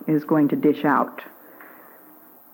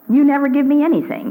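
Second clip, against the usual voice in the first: put-on high, fast, louder sulky child's whine; whining child; 7 semitones higher, 4 dB louder, faster than usual. A little child denied ice cream whines at the parent. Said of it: "A little child".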